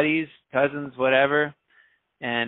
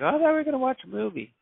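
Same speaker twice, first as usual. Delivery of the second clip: much higher, fast mock girlfriend voice; girlfriend's whine; 13 semitones higher, faster than usual. Model girlfriend protests to Qbert about the movie, high and fast. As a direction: imitate her quick high complaint.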